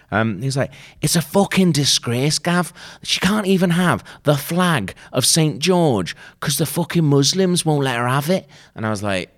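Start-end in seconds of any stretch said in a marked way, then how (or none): 1.0-8.5 high-pitched mock northern English outrage; bad northern accent. Colleague ranting to Gav in the newsroom, bad northern accent. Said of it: "that's not good accent".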